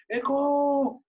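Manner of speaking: high, loud call into a cave; shouting Eco. Acted. Visitors shouting into the cave, high and loud. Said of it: "llegan y le gritan".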